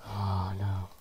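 breathy dread whisper. Real, not acted. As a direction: whisper it under your breath. Whispered dread during the game.

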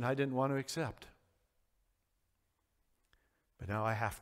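low, hushed, heavy admission; quietly owning a painful truth; subdued confession. Acted. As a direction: speak softly and low, with long pauses. Voicing Peter after his bitter weeping.

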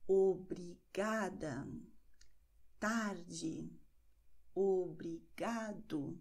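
obrigada and obrigado stressed on GA, slowly; weight on the second-to-last syllable of obrigado; slow demo, stress on GA and TAR; penultimate stress. Shown as a demonstration. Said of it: "betonen wir die vorletzte Silbe".

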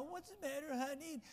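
high, soft, whining mock Ahab; mock a grown king sniveling to his wife; whiny Ahab. Preacher mocking Ahab crying to his wife, high whine.

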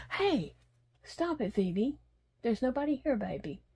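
hushing the dog. Real, not acted. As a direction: gently shush the dog.

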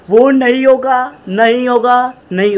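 loud, high, thrilled shouting; excited shout. Real, not acted. Excited denial about monetization rule.

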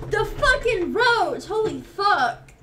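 slow, shaken yell after fright; scared horror game streamer. Real, not acted. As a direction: cry out, still startled. After roach jump scare.

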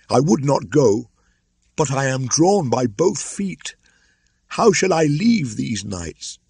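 high, loud grieving voice; anguished crying. Acted. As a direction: cry out, torn apart. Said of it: "cried Mowgli ... with a sob".

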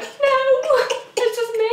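retold reply, squealed very high; exaggerated high, loud denial; high-pitched protest. Retold reply to mum, acted.

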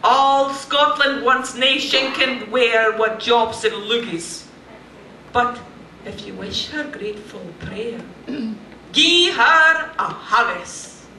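Burns recited in broad Scots; Scottish accent poem reading, lightly hammed; Scots poem recital. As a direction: declaim the Address to a Haggis in Scots.

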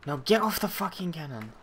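sharp, high exasperated shout at the screen; frustrated yell. Real, not acted. Yelling at game character.

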